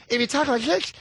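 high lateral lisp on "this". Shown as a demonstration.

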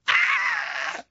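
sudden shrill shriek; high mock scream. Son screaming in fright, very high shriek.

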